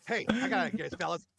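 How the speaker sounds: high, loud eager critter; eager creature voice. Acted. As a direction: pitch it up, over-eager. Player voices cow character.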